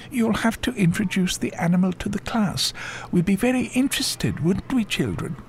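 very high schoolteacher voice; Miss Belltower. A narrator's reading. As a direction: address the class high, lips tight. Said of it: "making her lips go tight".

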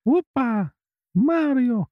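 very high, loud cartoon plumber yelp; Mario impression. Shown as a demonstration. Husband warming up his Mario voice, very high and loud. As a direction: bounce it out like Super Mario. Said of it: "think of Super Mario".

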